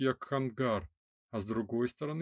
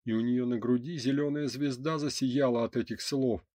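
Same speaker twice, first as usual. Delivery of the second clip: ordinary delivery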